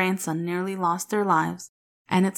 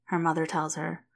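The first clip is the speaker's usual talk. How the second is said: plain reading voice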